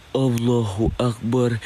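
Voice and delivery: slow, low invocation; drawn-out invocation. Real, not acted. Ghost hunter invoking God on seeing something, slow and low. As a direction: draw the words out low and slowly. Awed dread during night ghost hunt.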